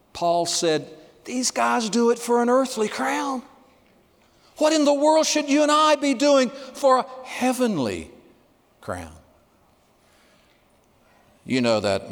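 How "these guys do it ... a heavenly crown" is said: rising exhortation. Acted. Preacher voicing Paul's exhortation.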